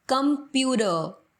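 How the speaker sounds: "कंप्यूटर" with a flapped t, "compuder"; flapped t "computer". Shown as a demonstration. Teacher showing the native flap. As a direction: soften the t. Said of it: "pronunciation होगी कंप्यूटर".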